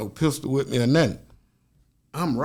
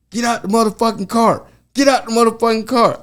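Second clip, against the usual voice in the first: cop shouting orders; 10 semitones higher than usual. Retold or imitated. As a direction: yell him out of the car at gunpoint. Cop with pistol drawn.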